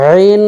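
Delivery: রিন as pharyngeal "'een"; throat-pressed Ain. Shown as a demonstration. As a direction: squeeze mid-throat on রিন, high and loud. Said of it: "চাপ দিয়ে".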